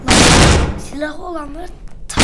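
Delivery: sudden loud shout at teammates. Real, not acted. Yelling at teammates in the game, loud shout. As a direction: yell it out across the match.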